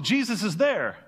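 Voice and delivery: ordinary talk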